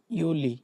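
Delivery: ইউলি said with a Y sound, oo-lee; German Juli. Shown as a demonstration. Teacher demonstrates ইউলি to students. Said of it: "জুলাইকে ডয়েচটা বলে ইউলি".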